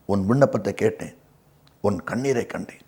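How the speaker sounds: quiet scripture promise, pitched well down; soft, low assurance. Acted. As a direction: quote it low and hushed. Quotes God answering Hezekiah's tears.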